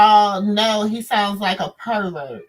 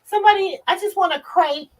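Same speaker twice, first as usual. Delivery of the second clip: put-on higher, pleading little-girl voice; mocking a needy girl; 10 semitones higher, faster than usual. Mocking the young girlfriend to viewers, higher pleading girl voice. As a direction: beg sweetly for a crepe like a spoiled girlfriend. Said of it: "she just looks so like".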